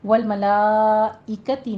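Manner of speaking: والملائکتی with laa held five counts; slow recitation, stretching the aa in والملائکتی; obligatory long madd. Shown as a demonstration. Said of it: "مد متصل واجب ہے".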